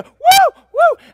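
high, loud "woo!"; excited whoop. Acted. Preacher showing Christians on fire for the Lord, excited whooping. Enthusiastic first-day painter cheering.

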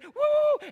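sudden high-pitched zooming whoop; high whooping "woo". Retold or imitated. Preacher imitating a newcomer whirling away, high whoop. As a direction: whoop way up high like something whizzing off.

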